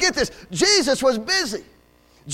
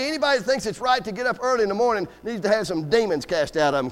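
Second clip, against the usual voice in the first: normal talking voice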